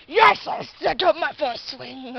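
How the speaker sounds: loud, drawn-out hammed-up boast; mock triumphant cheer. Imitating Lucas proud of his swing, mock triumphant. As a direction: crow it slowly and loudly.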